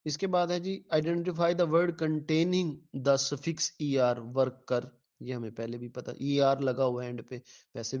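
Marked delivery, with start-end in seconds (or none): none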